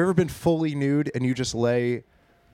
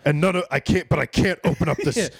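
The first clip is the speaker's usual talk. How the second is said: raised, put-on desperate father; Liam Neeson; 3 semitones higher than usual. Father who can't open the sleeping bag, mock desperate. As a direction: protest helplessly in a film-hero voice. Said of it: "it's like Liam Neeson in Taken".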